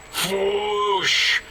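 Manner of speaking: mouth-made toilet flush noise; flushing sound. Acted. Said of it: "That is the sound of flushing".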